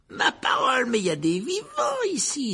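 high, mock-astonished ghost voice; theatrical surprise; exclaiming. A narrator's reading. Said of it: "s'exclama Sir Patrick".

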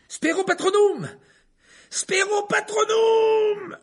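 hurlé très haut et fort, étiré; cri désespéré. Acted. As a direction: lancer le sort de toutes ses forces. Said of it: "hurla Harry". (Harry hurle le sort, très aigu et fort)

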